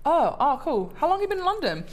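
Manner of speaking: high, bright surprised questioning; chirpy acquaintance. Retold or imitated. Acquaintance's reply acted out.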